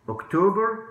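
ordinary talk

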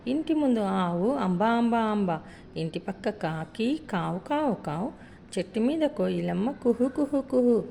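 put-on sing-song rhyme read to children, with lively animal sounds; children's rhyme. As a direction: chant it brightly for little kids. (పిల్లల పాటలా రాగంతో చదవడం)

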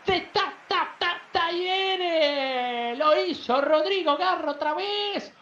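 drawn-out, high club name; stretched goal call. Real, not acted. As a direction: stretch out Talleres triumphantly. Stretched goal call after pause.

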